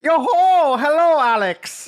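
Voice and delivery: high, loud hammy leprechaun greeting. Acted. Co-host greets the host as a leprechaun, hammed-up high and loud. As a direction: ham up a jolly leprechaun hello. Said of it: "your favorite leprechaun".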